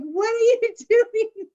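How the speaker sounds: high, slow disbelief broken by laughter; scolding him while cracking up; laughing reproach to the dreamy friend who missed the cab; exasperated laughing. Retold or imitated. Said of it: "I'm like".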